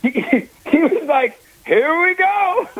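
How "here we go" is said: high, drawn-out imitated call; mock rallying cry, stretched out; retold eager call. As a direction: sing out the quote high and slow. Retold eager welcome of trouble.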